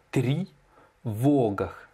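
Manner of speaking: тревогах split as "tri... VOO-gakh"; slow syllable breakdown. Shown as a demonstration. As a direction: draw out тревогах very slowly, long O. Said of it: "L'accentuation sur le O". (тревогах découpé lentement, « tri-VO »)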